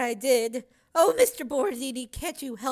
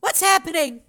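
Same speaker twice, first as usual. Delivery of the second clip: higher, louder frightened question; 4 semitones higher, 6 dB louder than usual. A narrator's reading. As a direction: call out in fear. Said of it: "Corey cried, frightened".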